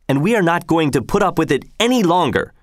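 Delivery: high, defiant protest at normal volume; understated shout. A narrator's reading. Arnold defying the governor over taxes, a mild high-pitched shout. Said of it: "shouted Arnold of Melchthal".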